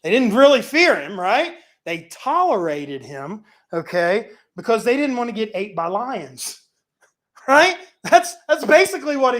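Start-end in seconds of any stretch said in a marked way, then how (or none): none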